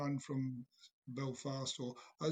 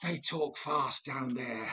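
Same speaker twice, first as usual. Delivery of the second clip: mock Belfast accent; 5 semitones lower, slower than usual. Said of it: "You said".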